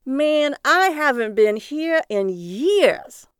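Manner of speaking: loud, high, slow delighted exclaim; excited. Acted. Cabbie arriving in his old town, loud and excited. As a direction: savor the homecoming out loud. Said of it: "The cabbie seemed to get excited".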